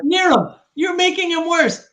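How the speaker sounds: high, loud exasperated scolding; exasperated protest. Real, not acted. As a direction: pitch jumps up in protest. Scolds Nero for worsening him.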